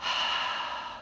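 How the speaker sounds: long audible exhale; calming sigh to show the breath; demo breath out. Shown as a demonstration. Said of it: "I'm gonna take a breath".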